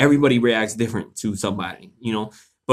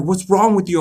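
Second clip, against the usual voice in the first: higher-pitched mock disbelief; retold alarmed protest; 7 semitones higher than usual. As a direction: act out protesting to the attacker, pitched up. Retold protest during stabbing.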